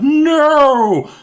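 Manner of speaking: high, loud drawn-out "No!"; hammed-up scream of terror; acted cry out. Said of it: "He cries out".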